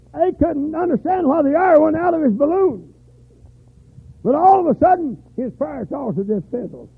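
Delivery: high, loud, driving sermon voice; fiery preaching. Acted. Preacher to his congregation, loud fiery preaching. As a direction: preach it out full force.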